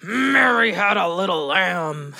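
slow, growled nursery rhyme; hammed-up anger, drawn out; mock angry. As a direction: play at being a furious kid. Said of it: "now try it like you're angry".

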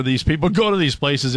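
ordinary talk, nothing marked

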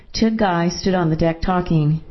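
ordinary delivery, nothing marked

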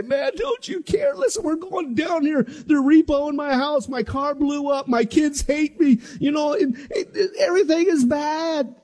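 high, frantic half-crying complaint; mock panic. Believer waking Jesus with hammed-up panicked complaints. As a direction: whine like a believer falling apart.

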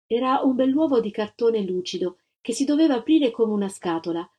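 usual speaking voice

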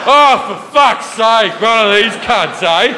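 mock outburst of fed-up Australians, slow and high; losing their shit. Australians stuck behind a slow traveller, losing their shit. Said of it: "they're losing their fucking shit".

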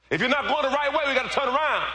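high, urgent preaching shout; full-force preaching. Real, not acted. Preacher urging the church to turn around, high shout. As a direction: raise it high to rouse the congregation.

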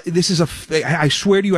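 usual speaking voice

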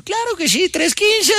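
very high, squeaky-pitched put-on voice; comic little high-pitched character answering the robber; joke character's reply. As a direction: pipe it up in falsetto. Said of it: "El otro".

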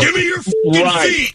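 high-pitched mock yell of a grandpa demanding his seat; mock angry shout. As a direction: yell it in hammed-up outrage.

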